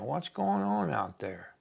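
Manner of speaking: high, hushed, drawn-out mock wondering; puzzled onlookers. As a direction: wonder softly, like baffled eavesdroppers outside. Egyptians outside wonder about the wailing.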